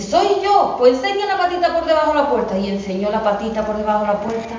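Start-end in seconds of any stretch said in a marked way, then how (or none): 0.0-0.8 much higher, thin put-on wolf voice; the wolf faking mother's fine voice; wolf squeaking high to trick the kids. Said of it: "ya tenía la voz muy fina". 0.8-2.6 put-on higher, fast kid-goat demand; higher fast goat kid asking for the paw; little goat kid. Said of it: "le dice los cabritos".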